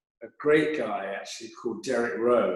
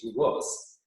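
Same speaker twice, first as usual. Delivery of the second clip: ordinary talk, nothing marked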